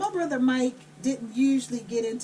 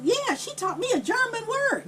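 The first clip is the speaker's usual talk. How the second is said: higher, eager imitated child; little boy; 9 semitones higher than usual. Boy reporting to Mom what sister taught him, high child voice. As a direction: play the young brother, pitched up. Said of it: "he was only seven or eight".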